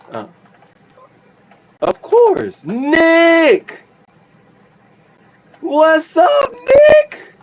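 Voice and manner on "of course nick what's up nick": high, loud, delighted hollering of a name; a happy shout across the room; excited greeting. Real, not acted. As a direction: call out to a friend who just showed up, thrilled. Excitedly greeting a viewer in chat.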